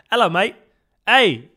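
loud, high mock-British greeting; bad British accent. Shown as a demonstration. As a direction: do a hammed-up, badly faked British accent. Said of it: "British accent is so bad".

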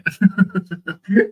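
loud high laughter. Real, not acted. Guest laughing with host about who was sober, loud laugh.

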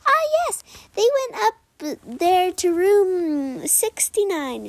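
high, squeaky little critter voice; little muskrat. Acted. Muskrat tells the unicorn where the birds went, high squeak. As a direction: pop up as a tiny eager muskrat. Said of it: "a little muskrat popped out and said".

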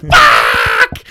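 loud low startled shout; startled yell. Acted. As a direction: yell like you got jump-scared. Acted scared viewers' yell.

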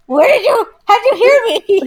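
high, loud disbelief through a laugh; hammed-up amazement, loud and high; mock incredulous. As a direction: squeal it as the astonished kid caught out. Acts out astonished child to parent.

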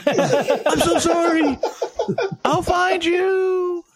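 very high, slow, laughing apology; high tearful apology. Acted. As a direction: squeak it out slowly, half laughing. Apologizing to thrown pet rock.

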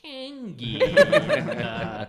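high, hammed-up posh customer laughing through it; fake posh disguise voice. Friend playing a disguised local ordering craft beer, hammed-up posh. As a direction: put on a silly fancy voice to stay incognito. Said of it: "and you're like".

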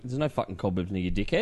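low, soft, scoffing brush-off; dismissive mate. Retold or imitated. Mate scoffing at his cobweb worry, low and soft.

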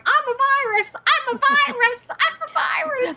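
squeaky little virus voice, very high; sing-song like a tiny cartoon germ; cute germ voice. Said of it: "a big thing on my screen going".

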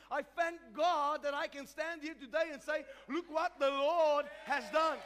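high, loud preacher's declaration; full-force testimony preaching. Real, not acted. Pastor testifies God changed his life, full-force preaching.

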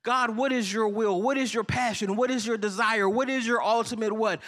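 prayerful plea. Acted. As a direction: ask God earnestly, slowing down.

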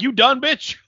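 high, loud sneering taunt; nasty taunt. Retold or imitated. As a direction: snarl it at her nastily. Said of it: "he's like".

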